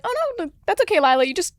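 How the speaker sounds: high-pitched placating crew voice; gentle correction, imitated. Testing crew correcting an over-eager young actor, high placating mimicry. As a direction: imitate someone letting a kid down easy.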